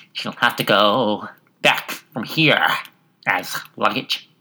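slow, drawn-out beetle. A narrator's reading. Beetle passenger speaking in turn, slow put-on voice. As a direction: draw each word out as a talking beetle. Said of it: "he went on with".

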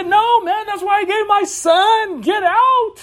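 a high, fervent voice as God refusing to send anyone away; God's emphatic reply. Retold or imitated. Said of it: "He said".

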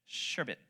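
Sherbet said "SHER-bit", low and soft; correct pronunciation. Shown as a demonstration. Preacher repeating the correction quietly, Sherbet as "SHER-bit". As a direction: drop the final R, Sherbet ending in "bit". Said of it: "the way you say it is".

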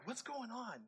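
hushed, suspicious little question; wary mock aside. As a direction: wonder it under your breath. Wary of someone following him.

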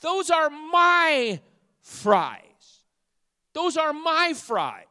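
put-on loud, high, drawn-out child's protest; hammed-up daughter guarding her food; indignant daughter. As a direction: squeal it as a possessive little girl. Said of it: "And she says".

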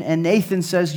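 usual speaking voice

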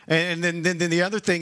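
ordinary talk, nothing marked

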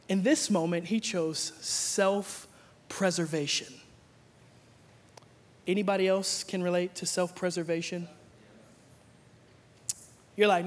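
ordinary talk

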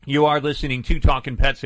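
normal talking voice

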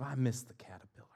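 quick unvoiced whisper of longing; mock whisper. Imagined butterfly watcher missing the caterpillar, whispered. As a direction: breathe it like a sad secret.

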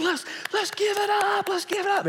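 very high, loud emcee call; rally fifteen hundred people; stage host hyping crowd; hype-man. Retold or imitated. Working up the crowd.

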